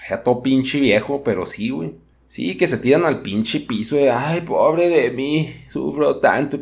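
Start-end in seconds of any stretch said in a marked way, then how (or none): 4.1-6.6 mock whiny lament. Mimicking mothers' dramatic complaints.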